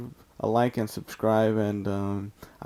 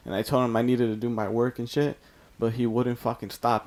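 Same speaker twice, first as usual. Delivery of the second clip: ordinary talk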